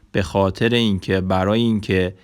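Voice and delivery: ordinary talk, nothing marked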